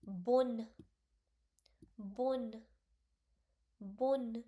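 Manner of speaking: "bon" punched loud each time; emphatic bon. Shown as a demonstration. Teacher drilling the word for students. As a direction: hit "bon" hard. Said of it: "You have to pronounce it like".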